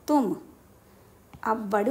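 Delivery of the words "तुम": ordinary delivery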